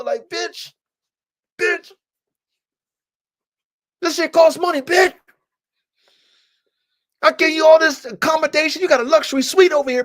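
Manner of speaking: high, loud angry rant at the dog; furious scolding. Retold or imitated. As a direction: rip into the dog for wrecking the roof. Yelling at Snow over the roof.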